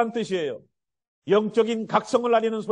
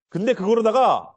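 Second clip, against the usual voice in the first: ordinary talk, nothing marked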